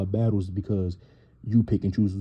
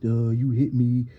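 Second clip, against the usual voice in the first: higher, louder, slow whiny cry of outrage; mock Natalie complaint; 4 semitones higher, 4 dB louder, slower than usual. Mocking Natalie after the smack, whiny outrage. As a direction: play up her wounded outrage. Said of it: "Natalie going off".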